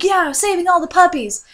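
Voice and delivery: high, loud cheer; cheerful rallying cry. Retold or imitated. Dreamer and beagle cheer loudly as they save puppies. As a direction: cheer on the rescue. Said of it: "we were like".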